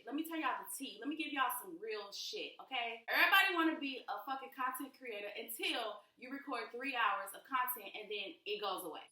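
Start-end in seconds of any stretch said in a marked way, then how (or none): 3.1-7.9 louder, higher, venting about lost footage; fired-up rant. Real, not acted. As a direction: let it rip, exasperated. Rant peak, cursing.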